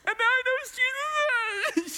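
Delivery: put-on a girl overcome that her friend now knows Jesus. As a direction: let it break into joyful tears. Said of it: "She just loses control".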